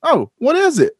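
high, bright eager question; mock-eager high reply. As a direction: jump in eager for the gig. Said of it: "I respond".

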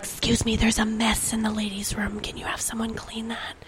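hushed embarrassed whisper. Retold or imitated. Visitors report a bathroom mess, embarrassed whisper. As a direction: whisper it like a secret. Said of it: "be like".

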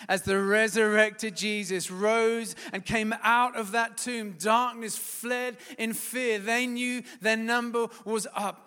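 loud rising sermon proclamation; full-force preaching. Acted. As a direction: proclaim it to the back row.

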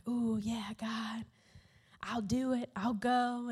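soft, hushed imitation of praying; mock prayerful. Jonah praying in the whale's belly, mock prayerful hush. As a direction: murmur it like a lightly acted prayer. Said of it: "he is just praying this beautiful prayer".

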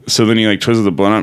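ordinary talk, nothing marked

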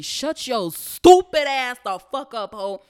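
scornful shut-up; mock angry retort. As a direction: tell him off, drawn out. Angry retort at coworker's lie.

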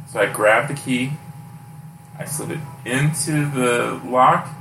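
ordinary talk

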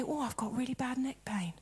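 hushed mock complaint; half-whispered moan about neck pain; whispered quote. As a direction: whisper it like a sore sufferer.

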